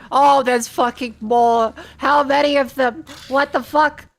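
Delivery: loud, high shocked swearing; shocked outburst. Real, not acted. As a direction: yell out in disbelief. More enemies, shocked.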